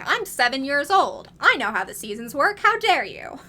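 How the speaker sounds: loud, outraged mock protest, rising to a high cry; indignant child. A child scolding the Disney filmmakers, loud and indignant. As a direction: huff like an offended seven-year-old who knows better. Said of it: "But I was like".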